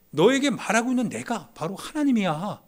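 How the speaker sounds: 높고 크게 외치는 예수님의 말. Acted. Preacher voicing Jesus' words. (예수님 목소리로 높고 크게 선포하듯)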